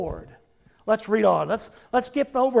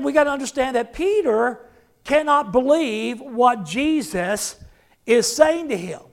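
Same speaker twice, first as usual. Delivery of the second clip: ordinary talk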